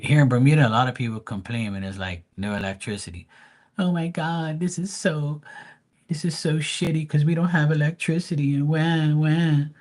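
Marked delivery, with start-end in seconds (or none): 3.8-9.7 high, whining mock Bermudian complaint; high-pitched whining parody of a complainer; mock whiny complainer. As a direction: whine like a spoiled local. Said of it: "Bermudians are like".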